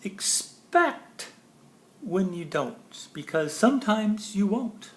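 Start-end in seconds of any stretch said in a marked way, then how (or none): none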